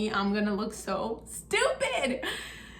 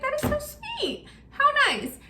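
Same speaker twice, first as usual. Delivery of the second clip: much higher, gushing with a laugh. Retold or imitated. Reacting to the free photographer, gushing high with a laugh. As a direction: squeal how sweet it is, half laughing.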